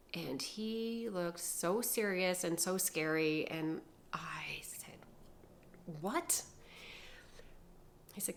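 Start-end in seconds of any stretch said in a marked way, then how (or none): 6.0-6.4 small, high-pitched startled question; stunned disbelief. Retold or imitated. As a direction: let it jump up in shock. Stunned at being accused.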